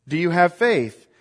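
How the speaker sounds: normal talking voice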